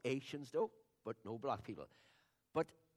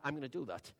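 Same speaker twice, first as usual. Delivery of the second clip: ordinary talk, nothing marked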